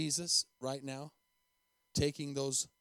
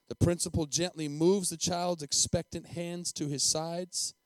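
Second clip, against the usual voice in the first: usual speaking voice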